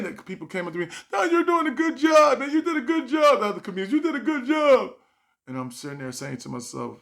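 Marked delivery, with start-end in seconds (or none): 1.1-4.9 high loud mock praise; consoling comics. Fellow comics consoling him, high mock praise.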